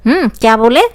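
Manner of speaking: ordinary talk, nothing marked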